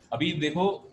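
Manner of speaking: ordinary talk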